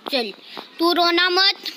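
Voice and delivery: ordinary talk, nothing marked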